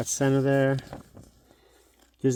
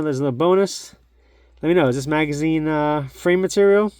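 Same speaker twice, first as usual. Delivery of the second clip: louder, higher sign-off announcer voice; upbeat outro; 4 semitones higher, 7 dB louder than usual. Acted. YouTuber signing off to his viewers, loud upbeat outro. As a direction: pump up the energy to close the video.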